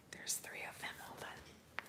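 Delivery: real whisper while filming the horses; soft unvoiced whisper outside. Real, not acted. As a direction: hush it so the horses aren't disturbed.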